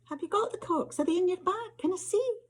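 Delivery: high, rushing questions full of eagerness; a girl begging to see, pitch climbing; eager questions. Acted. Jennifer pressing the narrator for the corks, eager and high.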